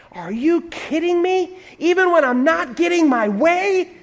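high-pitched disbelieving question; incredulous objection. Acted.

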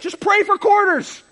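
high, loud, furious-sounding mock yell; angry order. Husband to wife before slamming the door, angry. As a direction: hurl it out as a parting shot. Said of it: "I slammed the door".

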